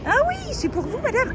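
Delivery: high, playful fawning voice; mock chauffeur greeting. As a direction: greet her like a celebrity.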